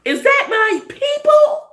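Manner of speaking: high, loud, slowing delighted call; excited recognition. Pretend. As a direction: call out thrilled to spot your people.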